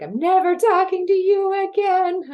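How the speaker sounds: high, loud furious shriek; angry screaming. Retold or imitated. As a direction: yell at your friend mid-drop. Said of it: "She's like".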